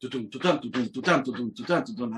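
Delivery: low, soft "tudum" rhythm; vocalised drum groove. Retold or imitated. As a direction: mouth a galloping drum beat. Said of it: "and he went".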